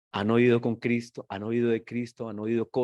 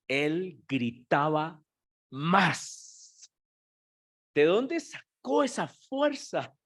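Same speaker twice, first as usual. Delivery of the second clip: preaching at full force; 7 semitones higher than usual. Acted. Preacher stirring the congregation about the blind man's cry, rising fervor. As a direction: preach it out, building wonder.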